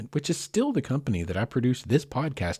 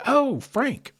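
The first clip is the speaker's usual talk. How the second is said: high, louder startled exclamation; startled yelp; 5 dB louder than usual. Retold or imitated. Telling listeners how the light flash scared him, startled yelp. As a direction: cry out as if suddenly scared. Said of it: "it scared me".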